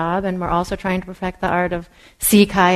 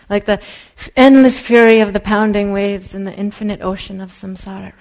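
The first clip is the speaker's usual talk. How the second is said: lightly voiced Tibetan teacher reading a poem; Tibetan accent; 7 dB louder than usual. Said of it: "he says, in this beautiful accent".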